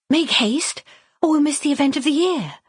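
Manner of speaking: put-on high, eager young woman's call; Violet calling out. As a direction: hurry her sisters along brightly. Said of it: "Violet called".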